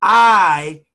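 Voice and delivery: very slow declaration, building in volume and pitch; lead the affirmation. Shown as a demonstration. Coach has listeners repeat after, slow building affirmation. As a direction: draw out each word, firmer every time. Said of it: "I want you to say this with me".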